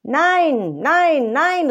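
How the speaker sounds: Peter's third panicked denial; desperate denial. Acted. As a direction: cry it out desperately. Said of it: "rief Petrus".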